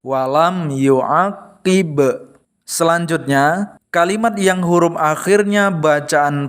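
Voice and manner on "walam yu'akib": walam yu'akib, very slow, the final b bouncing; walam yu'akib said slowly, short vowels. Shown as a demonstration. Teacher modelling the correct short walam yu'akib, very slowly. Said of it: "Harus ada pantulannya".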